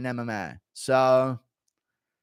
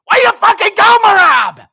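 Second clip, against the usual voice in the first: high, full-throttle cornerman yell; Matt Serra impression; 14 dB louder than usual. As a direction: holler at your fighter over the crowd. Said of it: "he will think that is Matt Serra".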